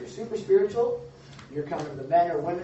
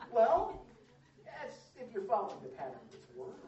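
ordinary delivery

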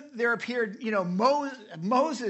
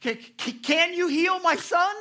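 much higher and louder, stammering plea; stutter, then cry out for help; desperate father; 12 semitones higher, 7 dB louder than usual. Retold or imitated. Father begging Jesus to heal his son, desperate high plea. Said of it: "ran over to him and said".

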